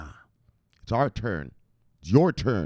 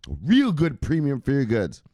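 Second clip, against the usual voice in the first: higher, louder, insistent; emphatic repeat; 4 semitones higher, 5 dB louder than usual. Real, not acted. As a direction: hammer the point home.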